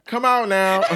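loud, high, laughing call for the guard; mock courtroom shout. Host jokingly orders the guard to remove her co-host, laughing shout. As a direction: yell for the guard through laughter like a fed-up judge.

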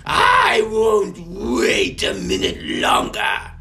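high, loud, slow zealous cry; fanatical witch. Acted. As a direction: proclaim it with wild fervor. Snitch ranting about dragons.

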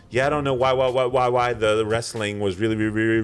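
loud, choppy stutter like a glitching call; mock glitchy video-call voice, stuck on repeat; stuttering bad connection. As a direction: repeat each word like a breaking internet line. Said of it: "it was, like, stuttering".

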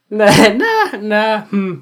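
high, loud laughing nah; giggly no-no-no; laughing denial. Real, not acted. Laughing off his own psychopath remark.

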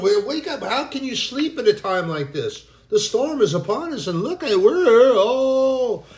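high, loud panicked wail; desperate plea. Acted. Disciples crying to sleeping Jesus, high loud panic. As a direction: beg him to wake up, terrified.

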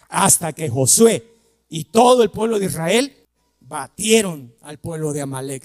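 usual speaking voice

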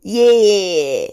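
loud, hammed-up Australian accent. Shown as a demonstration. Showing how her face scrunches up, exaggerated Australian accent. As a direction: show off a scrunched-up Aussie accent. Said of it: "when I do an Australian accent".

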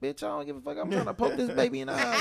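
put-on high, laughing kid voice; a hint of a defiant little boy; mock bratty kid. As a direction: brush off the mom. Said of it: "the little boy like".

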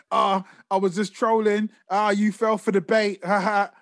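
hammed-up smug troll laugh; mock smug troll. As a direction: gloat like a troll caught out. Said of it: "And he's like".

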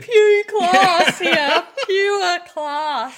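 cracking up mid-word; laughing. Real, not acted. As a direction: laugh through the words. Laughing at own story.

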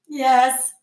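ordinary delivery

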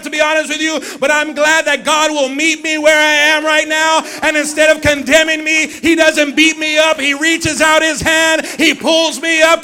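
loud, high, ringing preacher's cry; full-force preaching. Acted. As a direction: preach it out to the whole church.

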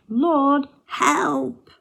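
high, loud cry of Lord help; frightened sailors shouting to the Lord; shouted plea. Retold or imitated. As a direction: call out desperately to God. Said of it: "and they shout".

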